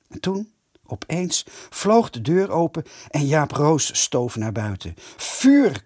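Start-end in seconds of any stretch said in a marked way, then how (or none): 5.2-5.8 high, loud battle command; commanding shout. A narrator's reading. Kees orders the boys to start throwing, shouting. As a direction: yell the order to open fire. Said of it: "commandeerde Kees".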